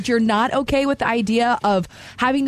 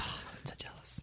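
whisper; 23 dB quieter, slower than usual. Real, not acted.

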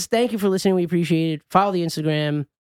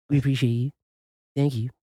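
put-on goofy voice; 4 semitones lower than usual. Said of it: "I don't know what voice this is that I'm doing".

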